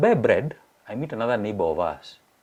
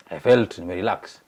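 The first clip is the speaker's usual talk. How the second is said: ordinary talk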